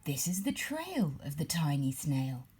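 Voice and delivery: usual speaking voice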